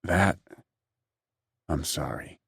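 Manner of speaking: words choked out, catching in the throat. A narrator's reading. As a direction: force it out through a tight throat. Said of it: "I choked out".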